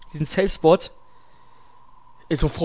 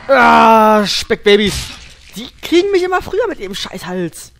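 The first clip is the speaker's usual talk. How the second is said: loud startled yelp, higher; scared yelp; 5 semitones higher, 8 dB louder than usual. Real, not acted. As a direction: cry out as monsters grab you. Monster attacks in horror game.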